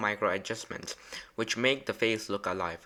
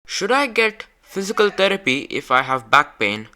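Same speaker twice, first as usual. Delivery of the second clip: loud, clear question; 9 semitones higher, 8 dB louder than usual. Real, not acted. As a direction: speak up high and clear for the robot. Asking the robot head a question.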